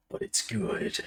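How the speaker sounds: gruff action hero whisper; action movie voice. Real, not acted. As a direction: say it like a movie tough guy. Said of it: "I said that like I'm in an action movie".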